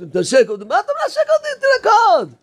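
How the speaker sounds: acted protest leaping very high in pitch; high, incredulous mock scolding; exasperated outburst. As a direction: squeal the objection, then cry the command. Acting out exasperation at being kissed.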